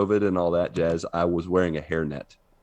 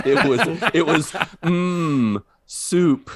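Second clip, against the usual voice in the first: high and loud, laughing; 11 semitones higher, 6 dB louder, slower than usual. Real, not acted. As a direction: crack up delivering the line. Acting the voiceover line.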